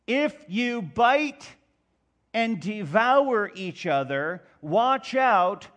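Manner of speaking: loud, driving sermon delivery; rising pulpit fervour, pounding key words; full-force preaching. Acted. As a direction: preach it hard to the whole room.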